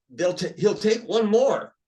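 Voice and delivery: loud, slow, high call from upstairs; drawn-out loud announcement; calling down the stairs. Retold or imitated. Somebody calls down the temple stairs.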